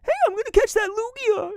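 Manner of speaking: very high, nasal-free whining brat; whiny voice. Retold or imitated. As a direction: squeal it out like a whiny kid. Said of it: "it sounded like he was whining".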